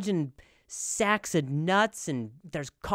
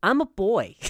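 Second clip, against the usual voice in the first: ordinary talk, nothing marked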